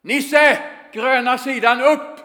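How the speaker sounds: shouted call. Retold or imitated. Coach yelling from the window to Nisse, full shout. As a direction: bellow it across the pitch. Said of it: "så skriker han".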